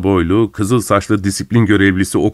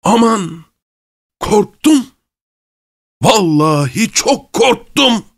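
very high, drawn-out mock terror; slow, high-pitched gleeful taunting; mocking fright; 11 semitones higher, slower than usual. A narrator's reading. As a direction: jeer at him by pretending to be scared. Masked man mocks the officer.

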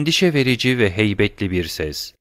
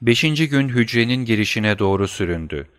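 ordinary delivery

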